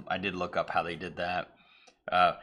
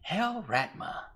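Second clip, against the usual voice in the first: slow, higher, eerie greeting; creepy sign-off; 11 semitones higher, slower than usual. Retold or imitated. Possessed anchor signing off, slow eerie chant. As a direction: drag it out like a possessed cultist. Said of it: "she just goes".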